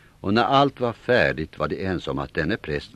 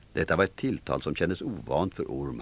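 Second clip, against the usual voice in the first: plain reading voice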